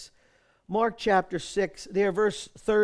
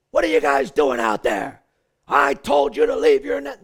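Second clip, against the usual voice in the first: loud, low scolding shout; mock scolding; 7 semitones lower, 7 dB louder than usual. Jesus scolding his fishing disciples, mock angry shout. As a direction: bark it like an angry boss. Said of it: "and he doesn't say".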